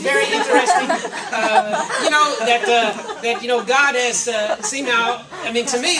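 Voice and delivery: amused laugh breaking in. Real, not acted. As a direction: chuckle while you talk it off. Laughs at own word list.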